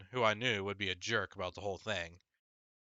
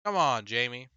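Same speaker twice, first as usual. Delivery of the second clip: louder, stern rebuke; angry mom; 3 semitones higher, 7 dB louder than usual. Acted. Mother scolding her younger son, louder and stern. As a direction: scold him firmly. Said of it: "said Mom angrily".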